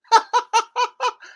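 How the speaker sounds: high, hammed-up cackle; mocking laugh. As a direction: fake a big laugh.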